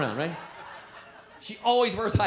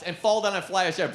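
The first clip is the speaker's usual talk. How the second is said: normal talking voice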